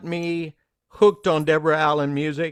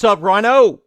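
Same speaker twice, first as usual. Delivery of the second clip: loud; 4 semitones higher, 10 dB louder than usual. Real, not acted. Host greeting co-host on air.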